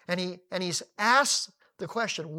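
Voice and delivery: ordinary delivery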